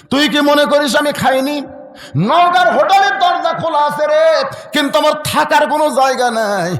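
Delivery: put-on a wailing old father begging for a bed; pleading father. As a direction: cry it out to the son.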